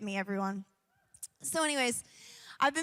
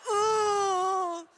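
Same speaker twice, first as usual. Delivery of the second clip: dramatic ooh; 7 semitones higher, 7 dB louder than usual. Retold or imitated.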